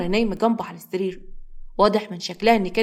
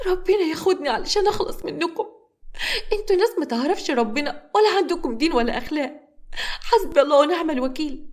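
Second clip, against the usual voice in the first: high, weeping; crying lament; 8 semitones higher than usual. A narrator's reading. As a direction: wail in despair. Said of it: "وتفضل تعيط".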